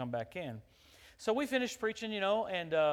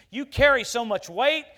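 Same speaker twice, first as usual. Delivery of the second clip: loud, rising preacher's cry, then slower; full-force sermon peak; preaching climax; 6 semitones higher, 11 dB louder than usual. Acted. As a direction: hammer the power of the church home.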